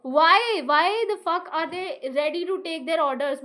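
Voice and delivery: ordinary talk, nothing marked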